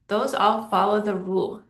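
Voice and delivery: ordinary talk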